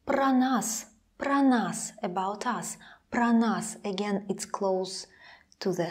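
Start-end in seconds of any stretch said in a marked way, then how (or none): 0.1-1.9 "Про нас" with про as "pra"; pro said as pra. Shown as a demonstration. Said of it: "it sounds like a". 3.1-3.8 "Про нас" said "pra nas"; pro said as pra. Shown as a demonstration. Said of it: "it sounds like a".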